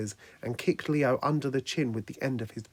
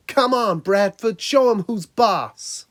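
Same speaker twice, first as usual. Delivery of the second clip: high, loud crowd heckle, drawn out at the end; jeering yell; 8 semitones higher, 8 dB louder, slower than usual. A narrator's reading. Onlookers urging their ninja against Leonardo, yelling and jeering. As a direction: holler encouragement to the fighter, stretching the last words. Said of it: "They were yelling and jeering things like".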